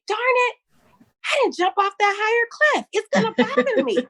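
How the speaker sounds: high, playful mock lament; mock regret. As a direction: whine it like a silly imagined future self. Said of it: "I'm not gonna say".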